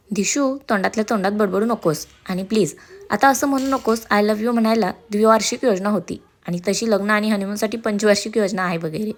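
plain reading voice

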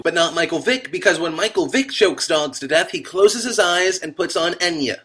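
ordinary talk

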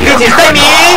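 big high yell of a catchphrase; excited shout. Pretend. Gamer shouting a cartoon catchphrase, loud. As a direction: belt it out like a cartoon kid.

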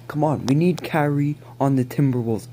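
usual speaking voice

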